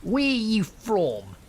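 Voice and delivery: put-on heckler's voice, stretched and high; accosting stranger. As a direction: call it out slowly as a confrontational man. Drunk stranger accosts her.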